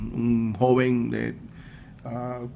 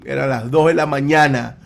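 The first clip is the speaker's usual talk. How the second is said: normal talking voice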